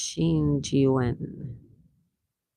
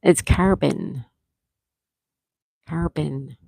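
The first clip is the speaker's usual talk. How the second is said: Buffalo accent on carbon; slower than usual. Shown as a demonstration. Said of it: "When you say carbon, you can hear it".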